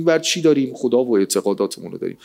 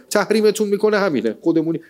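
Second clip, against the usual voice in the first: usual speaking voice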